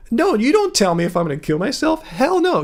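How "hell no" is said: emphatic hell no. Real, not acted. Defiant refusal, high pitch.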